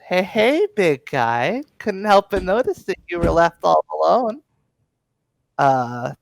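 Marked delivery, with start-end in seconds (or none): 0.1-4.4 high, teasing come-on; a playful higher flirt; flirty approach. Acted. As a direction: chat him up, pitched up. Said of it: "leans over and says".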